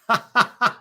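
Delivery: loud, high cackle; laughing. Real, not acted. Streamer reacting to a silly coach quote, loud laughter. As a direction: burst out laughing at the absurd line.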